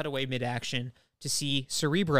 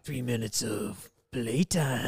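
gruff tough-guy voice; 4 dB louder, slower than usual. Retold or imitated. Archangel voiced in retelling.

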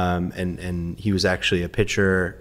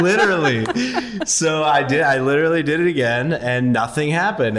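laughing; 7 semitones higher, 3 dB louder than usual. Real, not acted. Laughing, retelling it.

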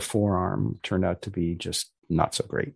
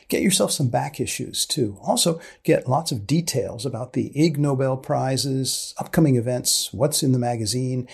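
bright, higher announcer voice selling the show; outro announcer read; 5 semitones higher than usual. Acted. Host signing off to podcast listeners, announcer read. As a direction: read it like a radio sign-off, upbeat.

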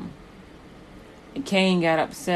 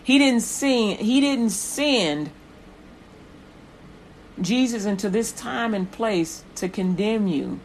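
fervent preaching; 5 semitones higher, 4 dB louder than usual. Acted.

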